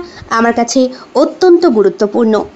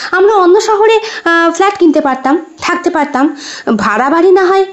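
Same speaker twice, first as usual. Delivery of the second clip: higher-pitched wife, gently reproachful; questioning wife; 4 semitones higher than usual. Acted. Wife questions her husband about buying a flat, higher-pitched protest. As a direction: lift the voice as a wife querying the expense.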